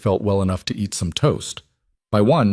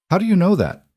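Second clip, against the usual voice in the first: put-on young child voice; 11 semitones higher, 3 dB louder than usual. Child asking the babysitter.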